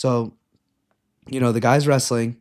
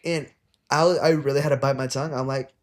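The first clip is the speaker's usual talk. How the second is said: ordinary delivery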